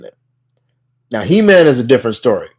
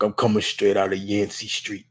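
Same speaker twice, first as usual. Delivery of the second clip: put-on low Brooklyn accent, softly tossed off; understated Brooklyn tough guy; slightly deeper voice with a Brooklyn accent; Ben Grimm; 4 semitones lower, 10 dB quieter than usual. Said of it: "straight Brooklyn accent coming out of him".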